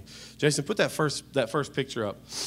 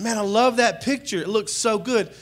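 a touch higher gushing; gushing delight; 8 semitones higher than usual. Retold or imitated. Pastor repeating his delight at the selfie, lightly raised. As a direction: gush over the picture again. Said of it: "and I said".

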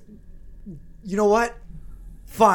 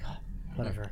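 sulky dismissal; 10 semitones lower, 12 dB quieter, faster than usual. Acted. Levi sulking, dismissive.

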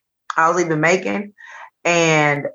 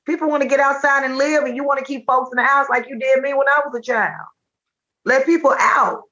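higher-pitched pushback at mama; exasperated retort; 8 semitones higher than usual. Retold or imitated. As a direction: argue back at your mother, voice raised in pitch. Acted retort to her mother.

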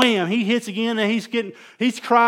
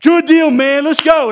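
loud, high, pumped shout; hype send-off; 8 semitones higher, 11 dB louder, slower than usual. Retold or imitated. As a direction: fire him up and send him off. Pastor hyping a new leader.

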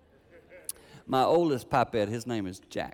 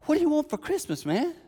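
much higher and louder; excited question; 5 dB louder than usual. Retold or imitated. Grandpa asking Jackson his Christmas wish, high excited question. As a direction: tease it out to the grandson. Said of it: "I said".

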